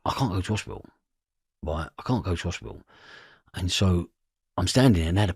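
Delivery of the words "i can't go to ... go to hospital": inner thought. Retold or imitated. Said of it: "and I'm thinking".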